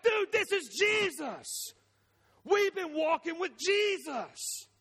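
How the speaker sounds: high, drawn-out astonishment; hammed-up, high-pitched disbelief; acted amazement. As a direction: play a stunned disciple, stretching the words. Acting disciples' sudden recognition of Jesus.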